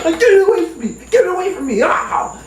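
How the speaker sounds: high-pitched frantic plea; flipping out. Retold or imitated. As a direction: squeal in hammed-up panic. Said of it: "I start flipping the fuck out".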